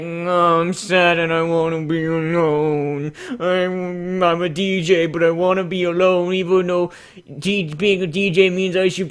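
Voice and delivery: slow, mopey put-on voice; hammed-up melancholy; mock sad emo. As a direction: sulk like a lonely emo DJ. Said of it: "it's like".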